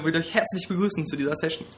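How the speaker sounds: ordinary delivery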